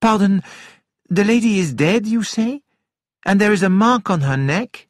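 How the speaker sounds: concerned questioner. A narrator's reading.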